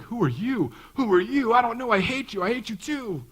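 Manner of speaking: high, loud hammed-up shouting match; mock bickering spouses. Empty-nest husband and wife suddenly shouting at each other, hammed up. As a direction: overplay a couple yelling at each other. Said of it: "they look at each other and they're like".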